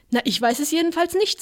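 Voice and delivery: high, indignant comeback on nicht; indignant retort. Retold or imitated. Vincent to the stranger, mock indignant retort. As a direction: throw "nicht" back up high, offended.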